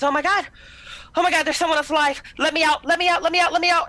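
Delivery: high, loud, panicked pleading; a trapped scientist begging to be let out; frantic panic. Acted. Trapped doctor begs survivors through glass, frantic high panic. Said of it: "banging frantically on the glass".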